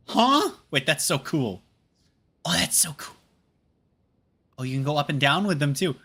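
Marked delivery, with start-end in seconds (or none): 0.1-0.5 sudden high startled huh; surprised huh. Real, not acted. Sudden discovery in game. 2.4-3.2 whispered awe. Real, not acted. Amazed by game feature.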